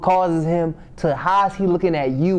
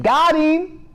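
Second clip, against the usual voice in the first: gloating taunt; 7 semitones higher, slower than usual. Acted. Gloating at Samson's capture.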